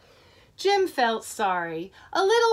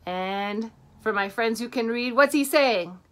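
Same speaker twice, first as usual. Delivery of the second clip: usual speaking voice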